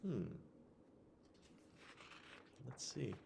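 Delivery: ordinary delivery, nothing marked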